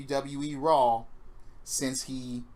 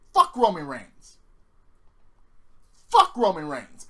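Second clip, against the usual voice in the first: emphatic disgust; 2 semitones higher, 7 dB louder than usual. Real, not acted. Wrestling fan cursing the champion-to-be, emphatic disgust. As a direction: hammer it out louder, word by word. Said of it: "I can't emphasize that enough".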